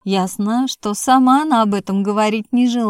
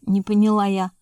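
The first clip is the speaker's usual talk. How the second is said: ordinary delivery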